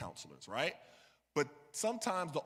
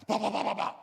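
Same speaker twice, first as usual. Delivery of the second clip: nervous bah stammer; 6 semitones higher, 5 dB louder, faster than usual. Retold or imitated. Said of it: "That was my reaction".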